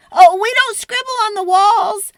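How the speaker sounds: loud high call to stop him; yelling. Retold or imitated. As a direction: shout it across the room to the dinosaur. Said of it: "I yelled".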